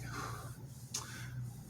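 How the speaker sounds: relieved sigh. Real, not acted. Relief after fire goes out.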